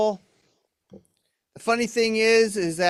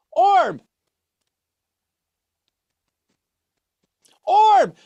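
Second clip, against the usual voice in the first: loud sharp yell of the name, pitched high; 10 semitones higher, 8 dB louder than usual. Acted. Ventriloquist yelling at his drunk puppet, loud and high. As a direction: shout to snap the puppet out of it.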